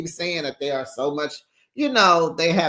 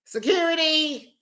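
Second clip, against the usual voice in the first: mock cry for security; 12 semitones higher than usual. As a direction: call for security way up high. Repeats mimicked call for security.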